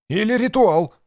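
very high, loud woman's voice; sudden interjection. A narrator's reading. Host's wife suddenly suggesting the ritual, high outburst. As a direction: pipe up abruptly, high and loud. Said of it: "вдруг подала голос жена хозяина".

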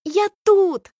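high excited shout; yelling. Acted. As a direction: call out to be seen. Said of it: "вопит Алька".

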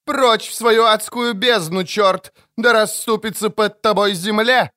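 high, ranting curse; exorcising priest. A narrator's reading.

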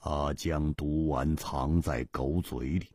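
low, soft, slow pondering; musing. Acted. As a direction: think it through aloud quietly. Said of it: "沉思着说". (姬冰燕沉思着说)

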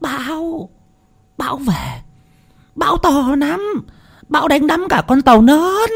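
very high, strained calling, held back in volume; mock loud yell. A narrator's reading. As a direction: repeat it as if yelling to the hard of hearing. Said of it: "Bà Tư lại hét to".

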